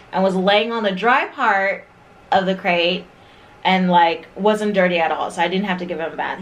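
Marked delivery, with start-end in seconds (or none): none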